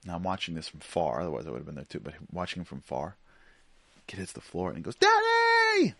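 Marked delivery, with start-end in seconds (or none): 5.0-5.9 very high, loud drawn-out shriek; imitated piercing child's cry for help; child's scream. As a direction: scream for Dad like a kid who just fell. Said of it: "Just screams".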